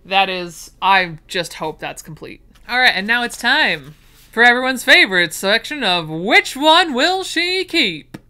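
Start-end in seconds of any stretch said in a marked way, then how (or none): 2.7-8.2 game show host. Acted. Introducing the keep segment, mock game show announcer.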